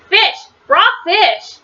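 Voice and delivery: high, disgusted storybook cry; mock scream. A narrator's reading. Missus Ralph tasting raw fish, high mock scream. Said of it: "she screamed".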